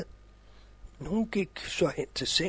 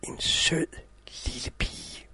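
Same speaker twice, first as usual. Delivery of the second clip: soft cosy storytelling; 3 semitones lower, 4 dB quieter than usual. A narrator's reading. Opening of a read fairy tale.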